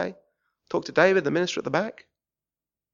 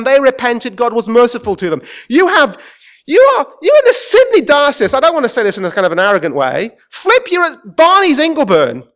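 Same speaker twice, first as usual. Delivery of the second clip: ordinary delivery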